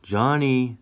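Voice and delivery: calling to wake someone. A narrator's reading. Voice calling to a sleeping Johnny, rising call. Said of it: "a voice calls".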